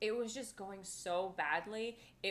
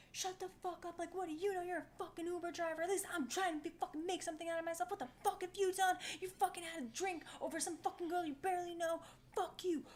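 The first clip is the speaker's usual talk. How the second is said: higher, fast furious tirade; retold rant; 10 semitones higher, faster than usual. Teller replays telling off the driver, higher fast angry rant. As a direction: rip into him without stopping. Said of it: "I was like".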